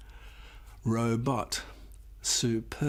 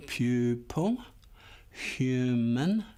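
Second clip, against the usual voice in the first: usual speaking voice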